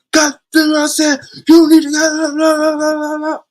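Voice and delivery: high, loud barking yell right in the ear; drill sergeant yelling. Retold or imitated. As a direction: scream it like a drill sergeant at a recruit. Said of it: "yelling in my motherfucking ear so goddamn loud".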